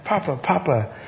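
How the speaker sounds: lightly voiced granddaughter calling; toddler voice. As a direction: coo it like a toddler. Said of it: "just those words melt me".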